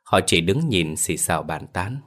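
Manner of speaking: ordinary delivery, nothing marked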